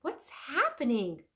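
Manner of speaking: crying out. Acted. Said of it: "cried Leonardo".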